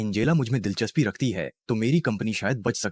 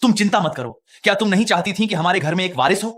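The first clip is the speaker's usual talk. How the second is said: insistent; 9 semitones higher, 6 dB louder than usual. Acted. Heated reassurance about an heir.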